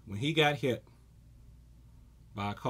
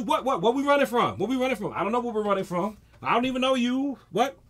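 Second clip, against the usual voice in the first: confused running; 7 semitones higher, faster than usual. Retold or imitated. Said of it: "I just started running to".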